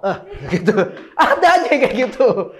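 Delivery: amused, breaking into laughter; laughing. Real, not acted. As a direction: laugh through the words. Laughing at own acted example. (sambil tergelak)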